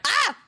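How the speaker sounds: sudden high startled yelp. Real, not acted. Streamer startled by a burger image, a sharp yelp. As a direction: jump at a scare and yelp. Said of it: "Jump scare".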